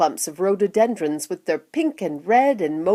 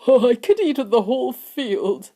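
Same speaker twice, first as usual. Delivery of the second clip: put-on raised, grinning old grandfather; a touch of gleeful relish; mild delight; 5 semitones higher than usual. A narrator's reading. Grandpa Joe answering Charlie after tasting grass, mildly delighted. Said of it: "grinning with delight".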